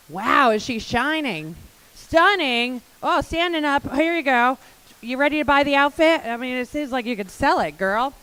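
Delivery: loud, high, gushing praise; excited hype. Real, not acted. Host gushing over the speaker's outfit, loud and excited. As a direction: cheer her on like she just stole the show.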